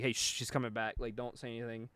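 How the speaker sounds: low, very soft imitated shushing; hushed warning. Friend warning she's coming back, hushed. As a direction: drop right down, as if she might overhear.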